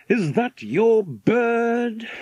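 out of breath from running; gasping. A narrator's reading. Man who has been running questions the girl, gasping. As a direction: gasp it out slowly, high and winded. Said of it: "he gasped".

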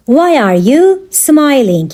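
measured, separated words; slow teaching repeat. Shown as a demonstration. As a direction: spell out the question for learners. Teacher repeats question slowly for learners.

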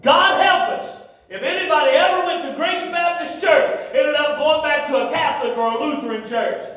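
high, emphatic pulpit exhortation; fiery preaching. Real, not acted. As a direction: drive each phrase home to the congregation. Preaching at full force.

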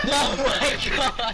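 laughing. Real, not acted. Crashing in game, laughing.

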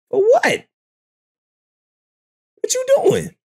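high, fast, outraged disbelief; incredulous shriek. Real, not acted. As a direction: shriek like you can't believe your ears. Streamer shocked at guest's interest.

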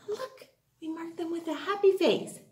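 ordinary talk, nothing marked

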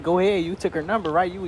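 ordinary delivery

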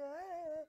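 high, loud "aah"; mock protest cry. Acted protest at second soup.

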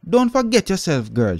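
sharp, high, loud scolding; shouting aunt. A narrator's reading. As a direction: snap at the girl from above. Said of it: "she shouted".

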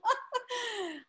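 high-pitched delighted laugh; real laugh, pitched high. Real, not acted. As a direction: burst into a high, happy laugh. Delighted thanks after warm introduction.

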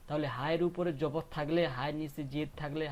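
usual speaking voice

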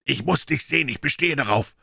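high, insistent demand; loud call. A narrator's reading. Lawyer insists on seeing his friend, calling loudly. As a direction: call firmly through a shut door. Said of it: "rief er mit lauter Stimme".

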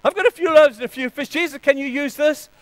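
put-on high, loud, excited child; eager little kid. Little kid offers his lunch to Jesus, eagerly. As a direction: pipe up keenly like a little boy. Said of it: "the little guy puts up his hand and says".

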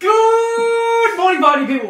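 loud, high, drawn-out "good" like a hype MC; hyped greeting. Pretend. Host greeting the party people to open the show, hyped and drawn out. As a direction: belt out a stretched, high greeting.

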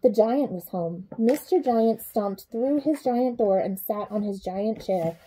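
ordinary delivery

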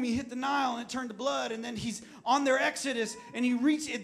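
ordinary delivery

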